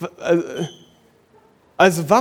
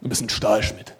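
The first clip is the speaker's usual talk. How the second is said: father's words; 9 semitones lower, 5 dB quieter than usual. Retold or imitated. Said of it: "mein Vater hat immer gesagt".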